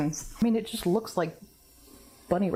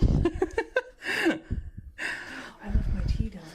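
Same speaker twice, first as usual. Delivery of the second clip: laughing; 4 semitones higher, much slower than usual. Real, not acted. Laughing at forgetting tea.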